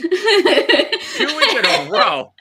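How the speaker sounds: high, loud, slow laughing outrage; laughing disbelief. Real, not acted. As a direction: stretch it out in disbelief. Disbelief at repeated noise.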